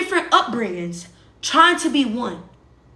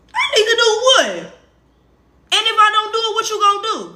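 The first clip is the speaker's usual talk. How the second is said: high, loud indignant retort, much higher and louder; angry outburst; 10 semitones higher, 5 dB louder, faster than usual. Retold or imitated. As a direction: snap back, outraged and defiant. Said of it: "That's how I used to be".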